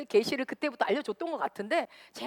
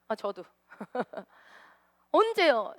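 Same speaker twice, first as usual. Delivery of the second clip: normal talking voice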